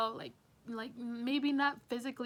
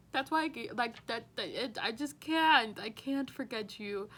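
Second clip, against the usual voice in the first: ordinary talk, nothing marked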